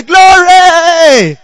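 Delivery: high, long-held shout of glory; rejoicing cry. Acted. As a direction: mimic a jubilant churchgoer, stretching the word. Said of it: "You rejoice".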